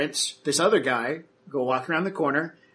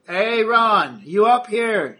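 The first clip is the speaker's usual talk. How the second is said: higher, louder call across a site; 7 semitones higher, 6 dB louder, much slower than usual. Acted. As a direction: yell up to a coworker upstairs. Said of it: "someone yells out".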